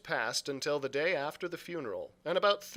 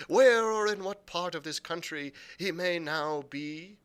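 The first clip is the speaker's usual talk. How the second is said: odd sing-song; 4 semitones higher than usual. Acted. Blind man calling to anyone nearby in sing-song. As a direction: call out into the air like a pitiful blind beggar. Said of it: "raising his voice in an odd sing-song".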